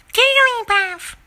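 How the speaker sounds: Jigglypuff voice. Acted. Jigglypuff cry.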